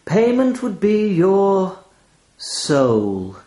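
high, slow, then a held gap before "soul"; pause before soul. A narrator's reading. Raven naming its price, pausing before "soul". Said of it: "left a tiny but palpable gap before the word soul".

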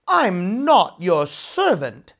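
loud, slow, biting retort; snapped. A narrator's reading. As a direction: spit it back at him. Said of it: "snapped Nora".

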